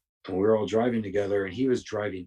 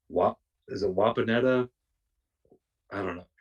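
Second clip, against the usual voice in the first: ordinary talk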